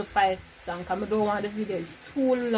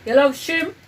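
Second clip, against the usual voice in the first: loud raised guesses; 6 semitones higher, 8 dB louder, slower than usual. Real, not acted. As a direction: call out over the music. Headphones on, shouting guesses.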